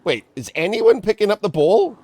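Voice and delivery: can't believe nobody's on the ball; incredulous. Real, not acted. Disbelief watching the game.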